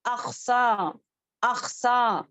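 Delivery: अक्सा drawn out from the back of the tongue; letter demo. Shown as a demonstration. Teacher sounding अक्सा twice for students. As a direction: repeat अक्सा from the tongue's root. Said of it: "अक्सर लिसान से अदा होने वाला है".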